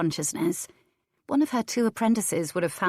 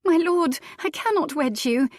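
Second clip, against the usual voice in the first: urgent refusal; 10 semitones higher, 4 dB louder than usual. A narrator's reading. Lady refuses the laird.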